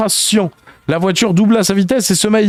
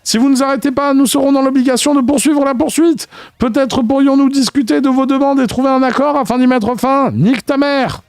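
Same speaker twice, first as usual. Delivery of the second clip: higher formal megaphone voice; higher stilted police announcement; pompous cop negotiation; 8 semitones higher than usual. Acted. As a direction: negotiate stiffly like a bureaucratic cop. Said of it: "menaces incessantes de Johnson".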